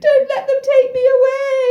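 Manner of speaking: high, wailing mock plea; desperate plea. Antigone to her father while dragged off, high desperate plea. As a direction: wail it out, pitched high.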